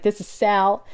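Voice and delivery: lightly put-on New Yorker voice, New York accent; a hint of a New York laundry guy answering the phone, New York accent; thick New York accent. Nurse imitating the laundry man on the phone, light New York accent. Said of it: "with this thick New York accent".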